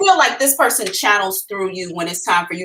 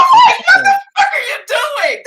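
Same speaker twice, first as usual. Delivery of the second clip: loud, very high squawk of disbelief; startled shout; 7 dB louder than usual. Retold or imitated. Psychic reacting to spirits making her retch, startled high shout. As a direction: shriek it at them in mock outrage.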